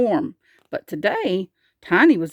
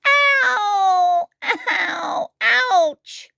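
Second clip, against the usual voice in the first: high, long wails of "ow"; repeated high yelps of pain; crying out in pain; 12 semitones higher, much slower than usual. A narrator's reading. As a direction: howl over a sore tooth. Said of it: "he cried".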